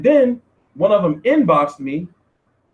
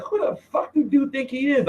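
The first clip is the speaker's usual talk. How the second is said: a high, scoffing "who the fuck"; brushing off a big name in disbelief; incredulous; 9 semitones higher, 4 dB quieter than usual. Retold or imitated. Scoffing at a message from Broner.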